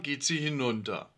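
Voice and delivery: ordinary delivery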